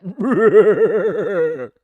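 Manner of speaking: loud, high maniacal cackle; unhinged villain laugh. Shown as a demonstration. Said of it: "Yeah, just laugh like that".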